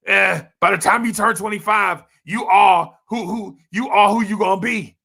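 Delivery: loud, high mock of the naysayers' line; mocking quote. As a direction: shout it like a smug pundit. Mocking the "done at 25" narrative.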